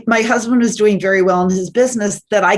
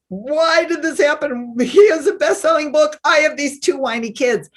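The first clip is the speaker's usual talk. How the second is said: complaining to God; 8 semitones higher than usual. Retold or imitated. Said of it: "I'm mad at God".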